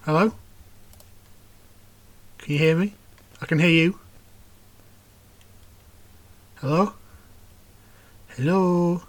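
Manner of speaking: broken-up voice on a bad call; call dropping out. Real, not acted. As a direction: voice cutting in and out over Skype. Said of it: "All I heard there was this crackling".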